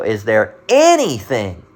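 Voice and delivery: "anything" drawn out slowly and stressed. Shown as a demonstration. Preacher shows congregation how italics are read, stressing "anything". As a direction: lean long and hard on "anything". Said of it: "how that would be pronounced in, in italics".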